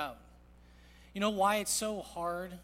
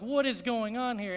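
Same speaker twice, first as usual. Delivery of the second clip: raised, higher-pitched mock exasperation; retold exasperation; 5 semitones higher, 6 dB louder than usual. Father walking into a madhouse at home, retold exasperation. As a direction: demand it loudly, as a retold reaction.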